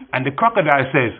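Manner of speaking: ordinary talk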